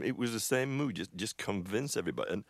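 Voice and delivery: ordinary delivery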